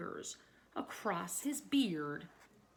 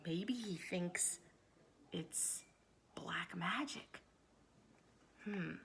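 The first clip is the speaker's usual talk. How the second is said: slow, soft near-whisper; hushed wondering; 3 semitones lower, 8 dB quieter than usual. Acted. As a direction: lower your voice as if sharing something spooky. Hushed guess for young listeners.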